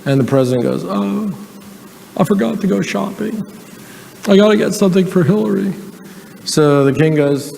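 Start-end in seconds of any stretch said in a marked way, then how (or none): 0.8-5.7 higher, sheepish Clinton voice; Bill Clinton impression. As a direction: remember the gift late, as Clinton. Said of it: "I can do his voice really well".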